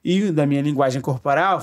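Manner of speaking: ordinary talk, nothing marked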